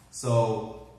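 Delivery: ordinary delivery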